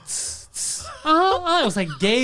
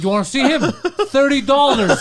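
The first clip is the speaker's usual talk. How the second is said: higher and louder, laughing hustle; pushy pimp; 8 semitones higher, 8 dB louder than usual. Retold or imitated. Poseidon hustles latecomers for cash, high and loud. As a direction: sell him like a pimp working the door.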